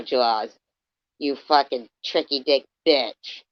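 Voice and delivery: ordinary talk, nothing marked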